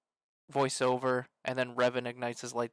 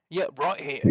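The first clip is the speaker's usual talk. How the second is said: put-on British accent; 3 dB louder than usual. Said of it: "it's the U K".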